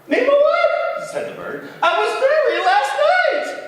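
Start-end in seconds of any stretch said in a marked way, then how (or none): 0.1-1.1 very high, loud, slow bird. Acted. Bird speaks next morning. 1.8-3.6 very high, loud, fast giggling bird; giggly bird. Acted. Bird tells moose news.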